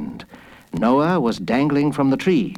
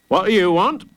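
high, sharp grocer voice; raised, brusque shop voice; unfriendly shopkeeper; 7 semitones higher, 4 dB louder than usual. A narrator's reading. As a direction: demand it curtly of a child. Gruff grocer questions boy.

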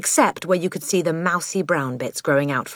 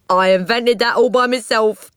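high, loud self-congratulation; proud boast; 7 semitones higher, 5 dB louder than usual. Acted. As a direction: crow about your own invention. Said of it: "he added proudly".

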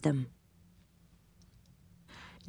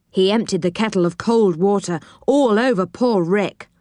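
plain reading voice

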